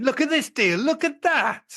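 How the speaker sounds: high, eager pointing out deals; excited bargain hunter. Retold or imitated. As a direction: sell the deal excitedly. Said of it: "everyone's going".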